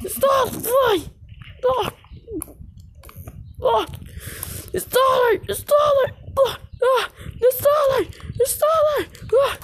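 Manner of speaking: high crying shouts of a name; terrified screaming. Acted. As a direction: scream for a friend in terror. Screaming friend's name in terror.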